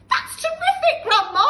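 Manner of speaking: put-on high, loud, thrilled boy; delighted cry. George amazed grandma stands, delighted cry. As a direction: cheer her on with glee. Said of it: "George cried".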